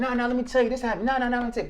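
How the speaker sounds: begging people to listen; mock frantic explaining; desperate insisting. Pleading to be believed.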